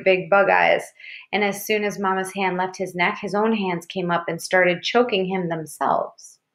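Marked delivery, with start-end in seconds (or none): none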